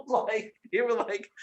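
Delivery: usual speaking voice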